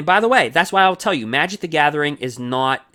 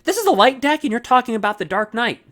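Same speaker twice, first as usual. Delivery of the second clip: mock viewer objection; 10 semitones higher than usual. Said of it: "It's like".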